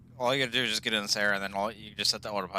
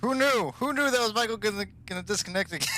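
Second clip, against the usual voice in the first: sarcastic; 10 semitones higher than usual. Acted. Mocking the friend's disconnect.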